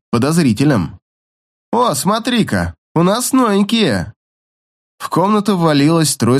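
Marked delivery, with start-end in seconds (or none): 1.7-4.1 high, slow mocking voice. A narrator's reading. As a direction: drawl it out with a smirk. New roommate greets them.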